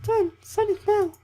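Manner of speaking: put-on very high girl voice; Slavia voice. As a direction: squeal it like a bright camp girl. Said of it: "threatening look".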